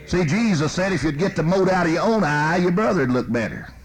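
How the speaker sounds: loud, fervent preaching; preaching at full force. Real, not acted. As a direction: drive it home to the congregation.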